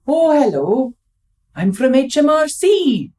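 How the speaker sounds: put-on slow, lightly done Welsh accent; a mild stab at a Welsh caller; hint of a Welsh tax officer; bad Welsh accent. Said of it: "Bad Welsh accent".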